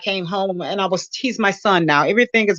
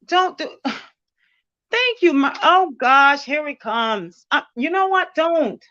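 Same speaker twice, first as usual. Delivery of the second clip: teary; 7 semitones higher, slower than usual. Real, not acted. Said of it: "I'm a wimp".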